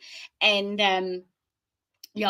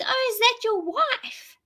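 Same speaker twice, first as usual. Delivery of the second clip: much higher, slower, lightly voiced stranger; imitating the woman; slower than usual. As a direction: play the curious woman asking. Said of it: "this woman turns to and says".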